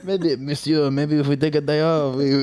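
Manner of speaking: put-on high, breezy French accent. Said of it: "I did that in a French accent".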